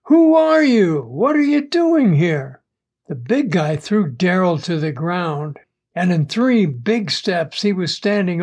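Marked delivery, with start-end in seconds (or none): 0.1-2.6 high, loud alarmed challenge; raised-voice startled demand; yelling. A narrator's reading. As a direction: yell at the intruder, starting high and easing down.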